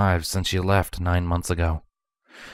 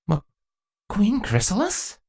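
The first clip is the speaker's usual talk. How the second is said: put-on higher, slow, hesitant young male voice; tentative question; 13 semitones higher than usual. A narrator's reading. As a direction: ask it timidly, unsure of the answer. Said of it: "a male voice asked tentatively".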